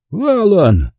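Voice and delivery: high-pitched excited call. A narrator's reading. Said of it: "закричал он".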